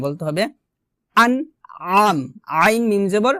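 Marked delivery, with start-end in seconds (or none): 1.2-2.2 slow আনআম, throat squeezed on আম; drawn-out ain in আনআম; pressed ain. Shown as a demonstration. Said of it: "আইনটা চাপ দিতে হবে".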